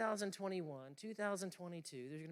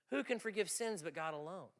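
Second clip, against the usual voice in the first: ordinary talk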